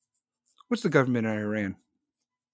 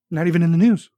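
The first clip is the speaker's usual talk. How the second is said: louder, higher indignant repeat; 4 semitones higher, 8 dB louder, faster than usual. Real, not acted. Ranting to chat about news, louder indignant repeat. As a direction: hammer it home, outraged.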